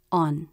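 "on" short, low and flat; unstressed ON. Shown as a demonstration. Teacher showing a function word, "on" unstressed, brief and low. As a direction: let "on" drop low without stress. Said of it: "The voice is unstressed in the word".

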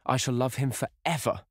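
high, impassioned vow; fervent cry. A narrator's reading. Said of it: "she cried".

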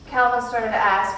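plain reading voice

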